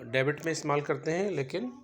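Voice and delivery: ordinary talk, nothing marked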